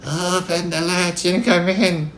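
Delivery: high, slow quavering granny put on by a wolf; wolf's drawn-out mock-granny falsetto; wolf imitating Grandma. A narrator's reading. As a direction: fake an old lady's quavering little voice. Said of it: "Trying to imitate Grandma's quavering little voice".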